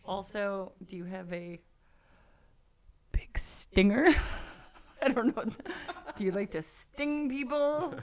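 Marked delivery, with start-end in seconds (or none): 0.1-4.1 breathy whisper on big stinger; teasing whisper. Acted. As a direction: hush the words big stinger.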